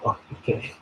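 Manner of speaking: breathy whispered reply; whispering. Retold or imitated. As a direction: whisper it in embarrassment.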